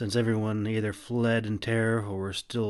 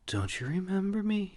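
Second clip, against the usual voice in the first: hurt question; 10 semitones higher than usual. A narrator's reading. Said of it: "she asked".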